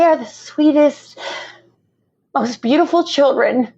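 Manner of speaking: crying while sharing her dream. Real, not acted. Guest tearing up to the host, crying. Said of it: "I'm crying and I'm sorry".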